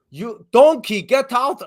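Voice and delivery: high, loud mock Russian guard; Russian accent. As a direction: order drivers out in a bad Russian accent. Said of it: "where we were Russian guards".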